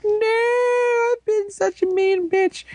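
very high, shrill falsetto lament; mock wailing lady. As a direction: wail it in a squeaky high voice like a melodramatic lady. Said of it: "And she's like".

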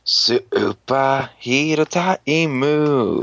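slow, drawn-out show title call; dramatic announcer. Acted. Host opening the episode recap, slow announcer call. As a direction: stretch it out like a TV announcer.

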